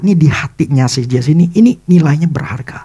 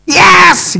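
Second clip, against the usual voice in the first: much higher, louder yelp of triumph; joyful shout; 6 dB louder than usual. Retold or imitated. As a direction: cheer like you just won. John overjoyed at free bike.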